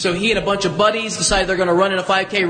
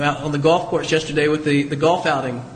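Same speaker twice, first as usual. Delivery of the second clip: ordinary talk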